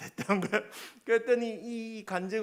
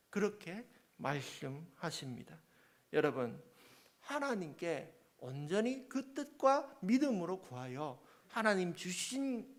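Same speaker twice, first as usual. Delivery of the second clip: ordinary delivery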